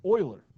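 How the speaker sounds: "Euler" said as OY-ler, loud and punched; German pronunciation. Shown as a demonstration. Teacher reveals the name to the class, "Euler" pronounced OY-ler. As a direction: say "Euler" with a German oy sound at the start. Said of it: "it's pronounced as Euler".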